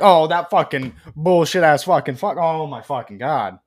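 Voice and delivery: loud mock rant, easing off at the end; exasperated Mark. Imitating Mark's reply on the phone, loud mock griping. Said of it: "Mark's over here like".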